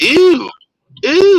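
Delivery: squealed high-pitched ew; disgusted ew. Retold or imitated. Reacting to her crude remark, a squealed disgusted ew. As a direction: recoil with a shrill gross-out.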